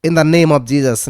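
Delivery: ordinary talk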